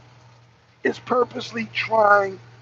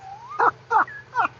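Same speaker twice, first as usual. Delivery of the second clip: high burst of laughter; a sudden high laugh. Real, not acted. Vlogger laughing at bacon in collard greens, high laugh.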